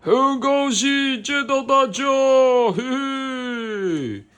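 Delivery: high, loud cartoon lion greeting. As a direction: play the friendly lion, chuckling. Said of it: "狮子说".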